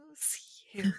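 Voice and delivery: cheeky whispered retort; whispering. Pretend. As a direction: hiss it back playfully.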